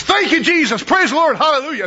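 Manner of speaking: high-pitched, sing-out preacher's praise; joyful outburst of worship, acted out; exuberant praise. Old preacher praises aloud among cussing drinkers.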